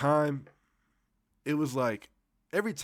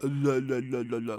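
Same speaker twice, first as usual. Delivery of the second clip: slurred syllables through a numb mouth; numb-mouthed mumble. Retold or imitated. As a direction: babble as if your lips are numb. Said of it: "I can't really talk".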